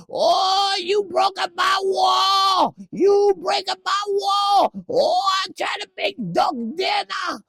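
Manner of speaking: put-on very high, loud accented shriek; Ted shopkeeper impression. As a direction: yell it like the angry Ted shopkeeper. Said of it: "That's what he sounded like in Ted".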